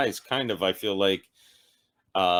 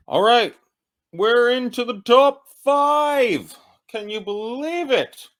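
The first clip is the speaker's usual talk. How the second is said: higher and louder, drawn-out hype; hyped announcer; 13 semitones higher, 6 dB louder than usual. Real, not acted. Back from a break, hyping the top five, excited. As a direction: sell the top five like a host.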